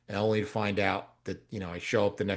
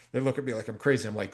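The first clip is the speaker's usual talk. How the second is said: ordinary talk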